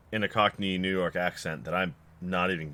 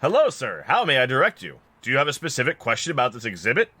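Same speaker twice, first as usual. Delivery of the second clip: higher-pitched, polite attendant voice; automaton exhibit attendant; 3 semitones higher, faster than usual. Acted. Automaton greets a visitor at the exhibit, politely helpful. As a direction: greet like an over-helpful museum guide.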